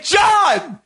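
sharp high shout of his name; yelling at roommate climbing into bed, sharp shout; calling out. Retold or imitated. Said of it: "Like, I say".

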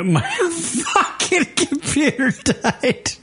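laughing through the words. Real, not acted. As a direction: laugh it out in disbelief. Computer just died on air.

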